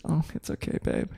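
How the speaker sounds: whispered, sweet comfort; mock soothing whisper. Imitating how his fiancée once whispered comfort when he cried. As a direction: hush him gently like she used to. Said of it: "She's like".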